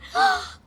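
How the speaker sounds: excited gasp. Acted. Delighted that dialogue recognized.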